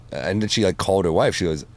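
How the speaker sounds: ordinary talk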